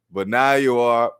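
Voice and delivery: normal talking voice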